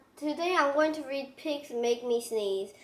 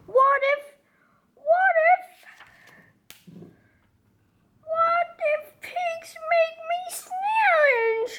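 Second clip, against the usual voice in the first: higher, rising worried pleading; fearful whine; 14 semitones higher, 4 dB louder than usual. Acted. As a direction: voice the dread of the idea. Gerald fearing pigs cause sneezes.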